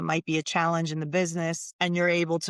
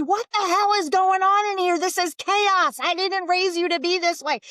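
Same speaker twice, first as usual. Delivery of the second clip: much higher, louder scolding shriek; mock yelling mom; 13 semitones higher, 6 dB louder than usual. Angry mom yelling at boys in the living room, hammed-up shriek. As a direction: screech at the kids like a frazzled mother. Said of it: "she didn't have to say".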